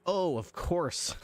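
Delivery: drawn-out, high mock sweetness; sarcastic. Clown doctor sarcastically answers the cop, high and slow. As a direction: play it sweet and syrupy, dripping sarcasm. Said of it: "Doctor Bubbles looks at you and says".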